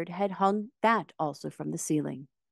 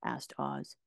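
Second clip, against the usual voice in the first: usual speaking voice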